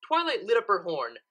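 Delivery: ordinary delivery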